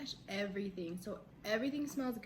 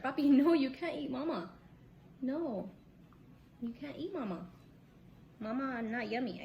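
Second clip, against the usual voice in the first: playful, pitched-up scolding; 3 semitones higher than usual. Real, not acted. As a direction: gently tell a little one no. Talking to her baby chewing her.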